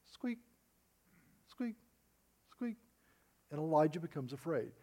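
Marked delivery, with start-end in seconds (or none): 0.0-2.8 tiny high mouse squeak, much higher and softer. Retold or imitated. Preacher mocking a mouse of a woman with a high squeak. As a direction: squeak it out like a timid little mouse. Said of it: "she's like".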